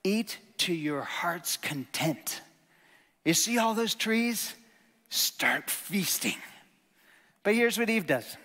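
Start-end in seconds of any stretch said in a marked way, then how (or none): 0.0-6.4 slow, lavish "eat to your heart's content"; open-handed, unhurried offer of plenty; generous invitation. Retold or imitated. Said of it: "if I can paraphrase it".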